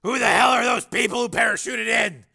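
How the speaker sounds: high, loud urgent demand; shouted question. A narrator's reading. Steele questioning Ice on the truck, urgent shout. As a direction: yell the question over gunfire.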